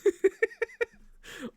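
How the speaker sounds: big high burst of laughter; loud high laugh. Real, not acted. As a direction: crack up loudly.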